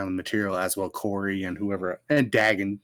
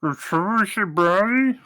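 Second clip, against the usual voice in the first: higher, louder, slow, mouth full; loud mouth-full call; 10 semitones higher, 5 dB louder, slower than usual. Acted. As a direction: shout it through a mouthful. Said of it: "you're talking with your mouth full".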